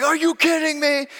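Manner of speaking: high-pitched, hammed-up furious yell; retold outburst, voice shooting way up; losing it, furious. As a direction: act out losing it at another driver. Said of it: "Pastor loses it. I'm just, I'm furious".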